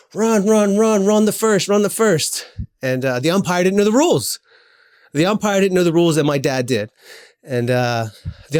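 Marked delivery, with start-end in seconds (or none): 0.1-2.5 retold maniac yelling. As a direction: yell at the runner like a frantic coach. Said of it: "my dad's screaming like a maniac".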